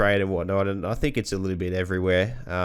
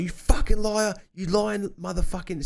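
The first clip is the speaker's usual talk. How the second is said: furious foreman; 12 semitones higher than usual. Retold or imitated. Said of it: "called him all that shit".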